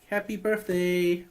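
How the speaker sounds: usual speaking voice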